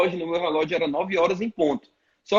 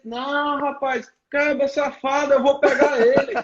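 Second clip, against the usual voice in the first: much higher, hammed-up furious pastor; mock fury; 8 semitones higher than usual. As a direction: ham up the pastor's threat with a laugh. Pastor fuming, half laughing. (fúria fingida, bem aguda, rindo no fim)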